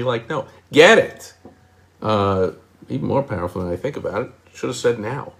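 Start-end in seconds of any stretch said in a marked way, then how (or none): 0.3-1.3 hammed-up loud get it; mock pushy quote. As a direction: get it, get shouted high. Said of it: "Sometimes people say".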